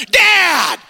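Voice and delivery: high, loud cry of Dad!; shrill-free high squeal calling dad; daughter's yell. Retold or imitated. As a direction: yell Dad! like an alarmed kid. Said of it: "Jay's like".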